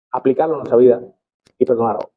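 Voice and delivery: ordinary delivery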